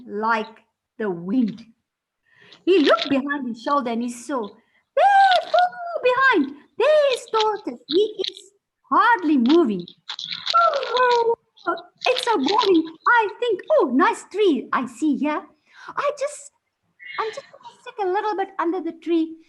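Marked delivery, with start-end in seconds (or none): 4.5-9.9 high, loud gleeful narration; excited. A narrator's reading. Hare looks back, mocking. 10.6-19.4 high, loud bored show-off; cocky hare. Acted. Boastful hare voiced.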